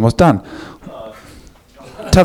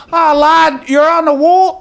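put-on a weak stab at a Scouse accent, pitched higher; half-hearted Scouse voice, pitched up; attempted Scouse accent; 12 semitones higher, slower than usual. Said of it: "I can't do a Scouse accent".

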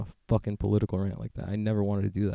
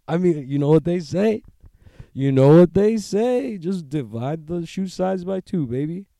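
mock swagger; 7 semitones higher, 6 dB louder than usual. Bragging about size.

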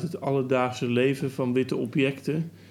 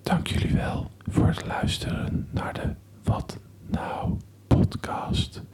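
asmr whisper. Pretend. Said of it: "Welkom bij deze A S M R video". (ASMR-fluisterstem)

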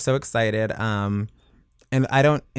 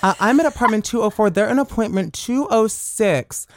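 put-on, higher-pitched complainer; prim mock caller voice, raised in pitch; concerned-neighbor phone voice; 5 semitones higher than usual. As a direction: play a Karen reporting the kids next door. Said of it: "the voice you were doing on the phone".

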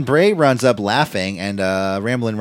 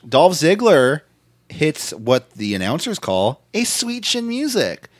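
normal talking voice

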